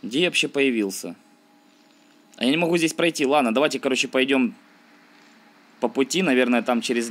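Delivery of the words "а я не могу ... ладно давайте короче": ordinary talk, nothing marked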